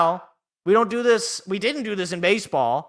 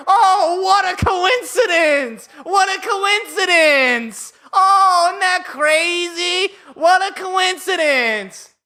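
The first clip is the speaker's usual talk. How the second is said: much higher, slow, sing-song fake amazement; sarcastic mock surprise; 12 semitones higher, 8 dB louder, slower than usual. Fan mocking the lottery result, sarcastic hammed-up wonder. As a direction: drip with sarcasm, pretend to be amazed.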